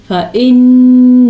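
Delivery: loud, held फ़इन्नल्लाह with a heavy Allah; full-voiced recitation. Shown as a demonstration. Teacher has students listen to the full word, full voice. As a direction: sing out फ़इन्नल्लाह, held on the nasal न्न. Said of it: "इसको पूरे को सुने आप".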